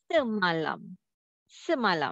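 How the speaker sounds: semalam joined up as one smooth word; read as whole word. Shown as a demonstration. Teacher showing the last step, semalam read as one word. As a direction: blend se, ma and lam into semalam without gaps. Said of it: "read the words".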